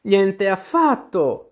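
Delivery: high, slow, emphatic indignant denial; indignant exclamation. A narrator's reading. Alice refuses to be snuffed out, indignant. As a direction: exclaim it stretched out, affronted. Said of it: "esclamò Alice indignata".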